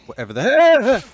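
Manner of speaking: loud high exasperated yell; frantic shout. Real, not acted. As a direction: shout it mid-fight. Frantic fight, enemy on fire.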